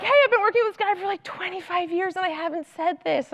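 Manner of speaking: high, fast, eager rush of words; excited confession. Retold or imitated. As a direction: blurt it out like a happy admission. Leaders admitting it, quoted.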